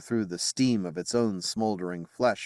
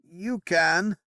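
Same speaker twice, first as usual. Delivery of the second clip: moaned; 10 semitones higher than usual. Acted. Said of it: "Bedivere moaned".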